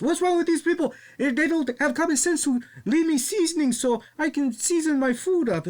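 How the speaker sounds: imitating La Parka flipping out. Mocking the wrestler flipping out over missing seasoning, very high. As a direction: blow up in a squeaky high voice. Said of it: "he goes".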